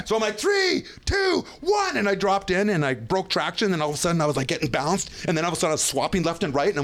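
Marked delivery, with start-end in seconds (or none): 0.4-2.0 high shouted countdown; excited countdown. Retold or imitated. Rider counting down at the top of the steep line.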